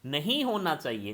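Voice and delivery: usual speaking voice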